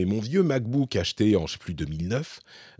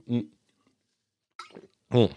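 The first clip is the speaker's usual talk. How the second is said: hums between sips of water; drinking to soothe the throat; while sipping a drink; 3 semitones higher than usual. Real, not acted. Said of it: "je bois un peu pour me". (en buvant une gorgée)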